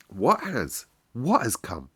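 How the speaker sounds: plain reading voice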